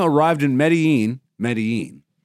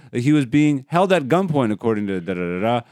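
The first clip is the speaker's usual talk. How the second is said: normal talking voice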